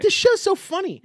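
high giddy wife impression; imitating wife. Husband mimics wife laughing at her show, high-pitched. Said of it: "She's like".

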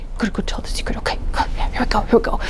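hushed whisper; sneaking off with the secret. Acted. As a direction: whisper to yourself.

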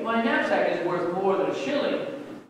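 plain reading voice

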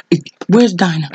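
ordinary talk

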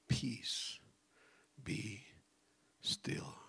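soft whispered command; hushed calm command. Acted. Jesus calming the sea, soft whisper. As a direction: hush the storm gently.

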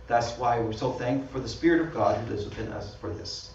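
usual speaking voice

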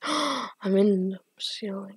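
gasp, then slow hushed words; startled whisper. Real, not acted. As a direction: breathe it out slowly, amazed.

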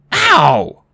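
high, loud cry of pain; pained yelp. Acted. Annabeth yelps as she is grabbed mid-air, high and loud. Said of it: "Annabeth yelled".

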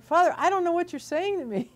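high-pitched exasperated protest, retold; re-enacted bewildered exasperation; exasperated retort. Acting out her confused reply.

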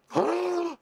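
high, shocked gasp; real startled gasp; sharp gasp. Real, not acted. Shocked by the film.

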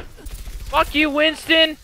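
high, loud cursing at the enemy; real furious shout at Winston; angry yell. Real, not acted. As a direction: spit it at the screen.

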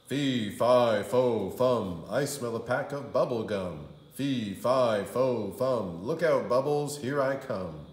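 loud booming giant chant; loud hammed-up giant. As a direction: stomp through it like a giant.

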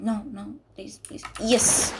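high pleading into cry of relief; beg, then burst with joy; panicking gamer. Real, not acted.

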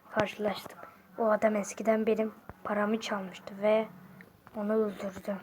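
low, very soft near-whisper; hushed confession. Acted. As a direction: confess the killing under your breath. Killer confesses coolly.

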